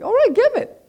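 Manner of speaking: high, loud playful okay; eager acceptance. Retold or imitated. God gladly accepting a gift.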